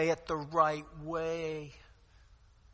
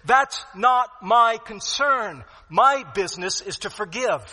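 emphatic declaration; 7 semitones higher, 13 dB louder than usual. Acted. Voices apostle's reply emphatically.

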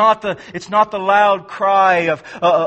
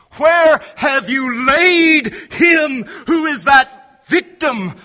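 voicing Jesus' question; 8 semitones higher, 5 dB louder than usual. Preacher voices Jesus' question.

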